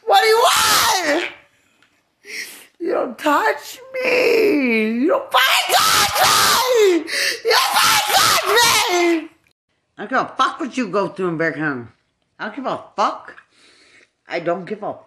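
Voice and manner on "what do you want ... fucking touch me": furious screaming. Real, not acted. Warning someone not to touch, screaming furiously. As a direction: scream it at full force, pitched way up. Real angry outburst at someone.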